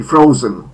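FROzen, stressed on the first syllable. Shown as a demonstration. Teacher models the third form, frozen stressed up front. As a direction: hit "fro" in frozen, short e in the ending. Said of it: "akcent na pierwszą sylabę".

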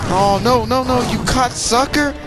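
high, loud frustrated cry; frustrated panic. Real, not acted. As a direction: cry out as he gets caught. Caught by the boss attack.

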